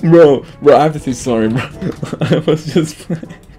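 loud start, breaking into high giggles; laughing apology. Real, not acted. Prankster saying sorry to his victim, through laughter. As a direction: apologise while cracking up.

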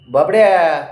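ordering the cat to wait, loud; shouting stop. Real, not acted. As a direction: bark the command at the cat. Calling the cat by name.

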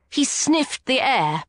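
ordinary delivery, nothing marked

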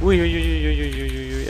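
loud, high rapid uys that fade down; alarmed string of uys; real alarm. Real, not acted. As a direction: panic rising, then easing. Danger approaching, alarmed.

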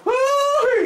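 a very high, loud woo-hoo; whooping cheer. Acted. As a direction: whoop like a rowdy cowboy. Whooping cowboy greeting.

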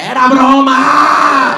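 high, very drawn-out yell; loud mock wail; panicked backtracking shout. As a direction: yell it long and high as a comic cop-out. Acted backtracking after being caught out.

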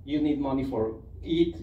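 normal talking voice

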